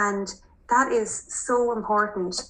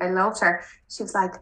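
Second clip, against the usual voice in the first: normal talking voice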